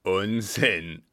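loud, curt dismissal; loud contempt; dismissive brother. A narrator's reading. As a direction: cut her plea off forcefully. Brother dismisses sister's plea.

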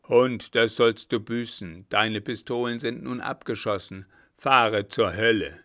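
loud, raging curse; furious robber. Acted. Robber curses Königsau as he strikes, loud furious. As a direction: swing the club and curse him.

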